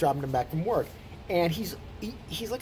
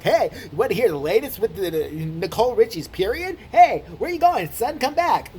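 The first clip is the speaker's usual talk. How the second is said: pestering, louder and higher nagging dad; annoying dad; 5 semitones higher, 5 dB louder than usual. Acted. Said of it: "Just really annoying. Like".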